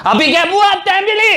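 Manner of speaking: high, far put-on voice; party loyalist cheering, high and put-on; loyalist caller. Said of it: "the loyalists".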